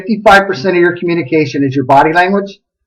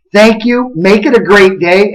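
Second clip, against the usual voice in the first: ordinary delivery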